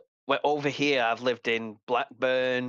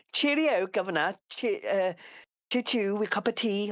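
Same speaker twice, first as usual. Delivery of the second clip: high, plummy posh English voice; mock posh Londoner, pitched up; posh London accent; 6 semitones higher than usual. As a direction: ham up a toff from London. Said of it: "your posh Londoners, which is".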